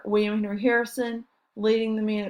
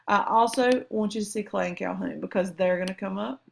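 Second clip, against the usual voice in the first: usual speaking voice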